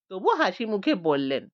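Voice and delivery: plain reading voice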